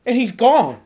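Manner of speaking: usual speaking voice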